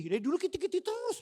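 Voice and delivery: squeaky high kiti terus; mock complaint. Pastor mimicking a churchgoer's high-pitched complaint. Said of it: "cuma itu aja".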